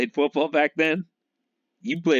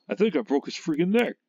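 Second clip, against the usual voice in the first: quick, higher, mock-stunned big guy; imitating a big actor, fast and higher; mock Richard Kiel quote; 6 semitones higher, faster than usual. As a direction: blurt it like the giant stunned at his own strength. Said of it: "And he's like".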